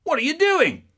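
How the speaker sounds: dad bursting in with a loud, high yell; angry yell. Retold or imitated. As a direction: shout it at the kids. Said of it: "he goes".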